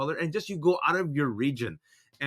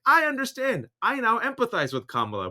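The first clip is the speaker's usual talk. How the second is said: mock earnest empathy; 9 semitones higher than usual. Everyone reacting to Kamala, high mock-earnest. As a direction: overdo the understanding. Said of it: "everyone's like".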